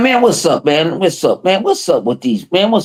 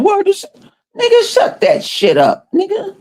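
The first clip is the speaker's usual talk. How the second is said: inner angry thought. Retold or imitated. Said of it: "That's what I'm saying in my head".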